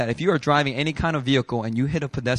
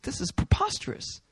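mock outrage; 11 semitones higher, 7 dB quieter than usual. Outraged at the law.

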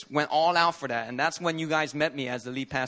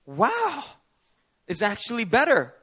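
surprised wow; 6 semitones higher, 3 dB louder, slower than usual. Retold or imitated. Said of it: "the guy would say".

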